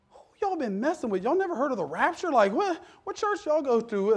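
high-pitched comic incredulity; mock disbelief. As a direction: act baffled, pitched well up. Mock disbelief at mourners never hearing rapture.

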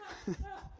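soft, high laugh; quiet chuckle up in pitch. Real, not acted. Preacher amused at his own accent joke, laughing.

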